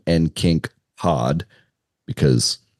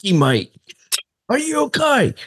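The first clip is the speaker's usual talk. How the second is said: mock Australian accent, much higher; a passable Australian, rising high. Guest mimicking Australian partner in Australian accent. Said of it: "C plus on that accent".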